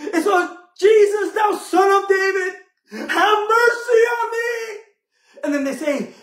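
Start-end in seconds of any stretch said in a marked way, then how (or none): 0.8-4.8 a blind beggar calling out to Jesus for mercy, wailing; desperate cry. Acted. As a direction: cry out to be heard over the crowd.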